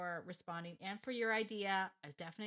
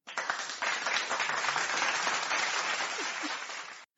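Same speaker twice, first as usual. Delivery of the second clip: loud breathy imitated crowd applause; mock applause noise; 11 dB louder than usual. Host cheering herself with a loud imitated applause noise. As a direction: hiss out a fake round of applause. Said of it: "I'm gonna do the applause".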